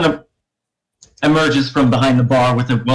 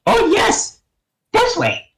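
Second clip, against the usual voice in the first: goblin voice; slower than usual. Acted.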